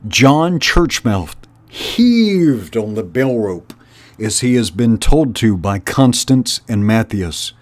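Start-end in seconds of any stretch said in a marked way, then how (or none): none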